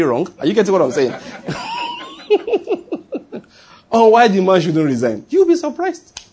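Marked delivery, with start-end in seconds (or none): 1.2-2.1 high burst of laughter; a sharp shouted laugh. Real, not acted. Laughs at own point. 3.9-6.2 high, louder amused remark; laughing aside. Real, not acted. Laughing aside after joke.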